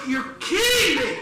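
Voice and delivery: high, loud incredulous cry; re-enacted outburst of disbelief. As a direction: exclaim it as a flabbergasted son. Son reacts to mother's excuse.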